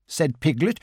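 usual speaking voice

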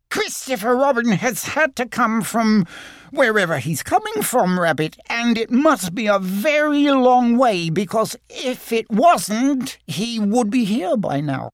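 high little Piglet voice, a touch flustered; small anxious animal explaining itself. Acted. Piglet explains to Rabbit, high and a bit flustered. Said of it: "a little flustered and a little hungry".

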